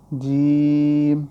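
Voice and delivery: جیم held long and loud; drawn-out letter name. Shown as a demonstration. Teacher spells for students, long drawn جیم. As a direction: stretch جیم out fully, as when spelling. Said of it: "تو ہجے کر لیں".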